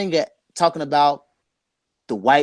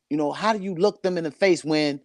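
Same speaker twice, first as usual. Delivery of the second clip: ordinary talk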